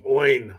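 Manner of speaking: "oin" with an i glide in the middle; "oin" like the coach says Owen; Shane's oin. Shown as a demonstration. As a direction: say "oin" as one quick gliding syllable. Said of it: "Shane pronounces with an, an I like the middle".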